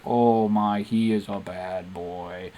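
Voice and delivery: low, slow, smitten aside; sultry swooning. Acted. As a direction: purr it, thrilled by the villain. Padme swoons as Newt threatens.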